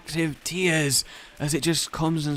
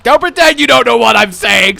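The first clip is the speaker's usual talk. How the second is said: mock yell; 11 semitones higher, 15 dB louder than usual. Mock insistent.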